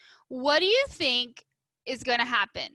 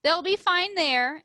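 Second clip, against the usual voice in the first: teacher's reassurance; 7 semitones higher, 5 dB louder than usual. A narrator's reading. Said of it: "said Mister Slinger".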